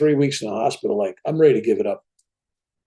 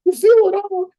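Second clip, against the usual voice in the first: very high, louder mock scream; inner scream; 5 dB louder than usual. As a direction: cry out desperately inside his head. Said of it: "I'm screaming in my mind".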